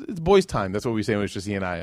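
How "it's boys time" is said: put-on higher, sing-song to a child; lightly higher cheerful words to a son; playful reassurance. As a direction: make it sound fun for the boy. Comforting son.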